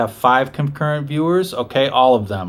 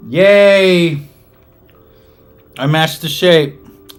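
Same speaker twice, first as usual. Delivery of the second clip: excited cheer; 6 semitones higher, 8 dB louder, much slower than usual. Real, not acted. Cheering a small game win.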